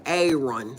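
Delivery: "Aaron" in her own country pronunciation; self-doubting pronunciation. Shown as a demonstration. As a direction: say "Aaron" low, as a doubtful attempt. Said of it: "I know this probably not right".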